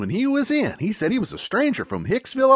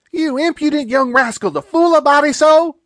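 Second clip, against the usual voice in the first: higher, louder mock scolding; delighted scolding; 6 semitones higher, 5 dB louder than usual. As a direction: scold him while rushing to hug. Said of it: "breaking in and jumping for him".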